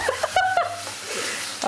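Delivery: squeaky breathless laugh; helpless high giggle; high unvoiced laugh. Real, not acted. Laughing at chili fumes.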